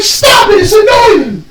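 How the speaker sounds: high mock shout as irritated mom; annoyed mom. Mom telling her son to stop, high mock shout. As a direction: squeal it like a fed-up mom. Mom scolds in retold scene.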